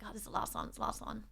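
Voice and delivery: a secret little sigh of relief to herself; hushed relieved whisper. Retold or imitated. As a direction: breathe it out almost voiceless, relieved. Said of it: "And I was like".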